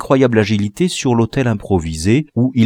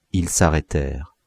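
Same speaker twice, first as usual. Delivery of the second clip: usual speaking voice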